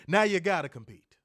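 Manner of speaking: loud punchy taunt; emphatic. Shown as a demonstration. Repeats line whispered.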